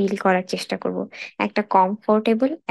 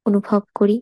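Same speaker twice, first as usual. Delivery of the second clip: guided meditation. Acted. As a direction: lead a body scan unhurriedly, phrase by phrase. Leads a body scan meditation.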